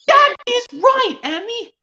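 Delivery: very high, slow cartoon character; squeaky cartoon voice. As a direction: chirp it out up high. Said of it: "see if I can do another impression".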